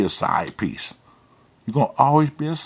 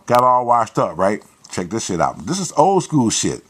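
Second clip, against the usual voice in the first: normal talking voice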